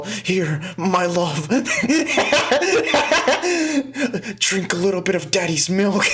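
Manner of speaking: hammed-up tender lover voice, laughing; mock tender. Mocking a vampire offering his blood, laughing.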